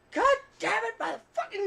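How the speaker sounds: very high, loud cursing yell; mock furious cursing. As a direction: scream the curses up high. Imitating Deadpool's cursing.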